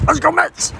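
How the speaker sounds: high cheer for the Mets; team cheer. Real, not acted. Vlogger rallying viewers before the game, high excited cheer. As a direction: call it out like a fan chant.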